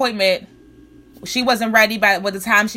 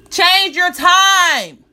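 slow emphatic order; 5 semitones higher, 4 dB louder, much slower than usual. Real, not acted.

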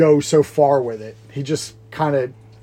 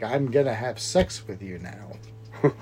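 low, slow, soft mock thespian; lightly voiced actor, slow and hushed; classical actor; 5 semitones lower, 9 dB quieter, much slower than usual. Host imagines classical actor in Body Heat, slow mock-thespian. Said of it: "This classical trained actor is like".

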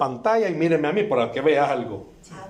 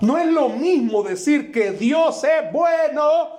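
high, slow, shouted; preaching shout; 9 semitones higher, 3 dB louder, slower than usual. Real, not acted. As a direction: shout it to the church, slowly.

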